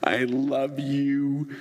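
drawn-out, laughing, delighted coo; blissful sigh. Pretend. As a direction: melt into the heated seat. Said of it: "you went".

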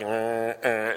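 slow, drawn-out undead groans; imitated walking-dead noise; zombie groan. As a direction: moan like a movie zombie. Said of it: "scene of The Walking Dead".

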